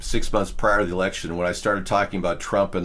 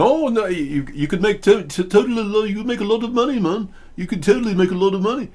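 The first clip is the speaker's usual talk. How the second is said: higher, fast eager hustler voice; imitating pushy salesman; 11 semitones higher than usual. Englishman luring him into gaming, higher mock hustler voice. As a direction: mimic the scammer talking him into it. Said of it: "And he was saying".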